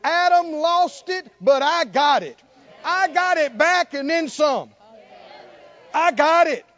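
loud, triumphant pulpit proclamation; full-force preaching. Acted. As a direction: shout it out like a victory claim. Preaches triumphant words of Jesus.

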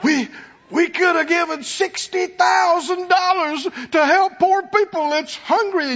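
high, pushy voice of a fake do-gooder; mock self-righteous Judas. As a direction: sneer it like a hypocrite faking concern for the poor.

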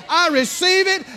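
joyful receiving. Retold or imitated. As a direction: say it gladly. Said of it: "you rip the paper off and you say".

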